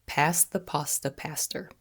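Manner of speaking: "Pass the pasta, pastor" in a soft, clear American accent. Shown as a demonstration. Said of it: "say it one more time together".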